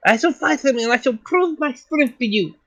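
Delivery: put-on a proud young fighter vowing to prove herself; girl voice. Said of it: "accent in that voice".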